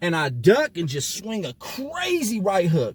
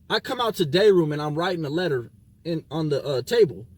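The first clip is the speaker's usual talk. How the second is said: usual speaking voice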